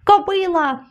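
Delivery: high, drawn wail at modest volume; storyteller's high plaintive cry; whining cry. A narrator's reading. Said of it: "скигнув я".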